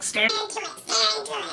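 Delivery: high-pitched mimicry of officers; mock the repeated command, high; mocking police order. Cops ordering her to stare, mocking high voice.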